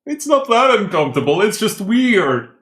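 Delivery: high, loud voice behind glass; high muffled voice. Acted. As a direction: answer in the trapped, muffled voice. Character in glass suit replies.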